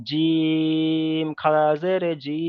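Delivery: slow, drawn-out letter-by-letter chant; slow recitation drill. Shown as a demonstration. Teacher modelling the letter line for students, slow chanted recitation. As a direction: recite each letter as a model, stretched slowly. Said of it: "তাহলে এখানে পড়তে হবে কি".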